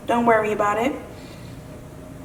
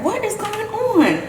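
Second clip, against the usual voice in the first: mock bewildered; 10 semitones higher, 4 dB quieter, slower than usual. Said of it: "I felt like I was gonna cry".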